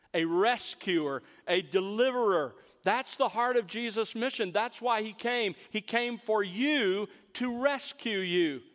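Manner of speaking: usual speaking voice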